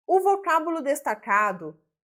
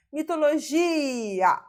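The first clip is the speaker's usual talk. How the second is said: "Mitologia" with a long, high GI; stressed syllable demonstration; 4 semitones higher, much slower than usual. Shown as a demonstration. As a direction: draw out the GI in "Mitologia" slowly. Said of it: "gi é a sílaba tônica".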